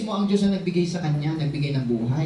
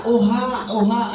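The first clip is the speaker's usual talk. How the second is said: mock newborn wail, a bit louder; baby cry; 2 semitones higher, 3 dB louder, slower than usual. Pastor imitating a baby's first cry, mock wail. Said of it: "Ang sinisigaw ng bata".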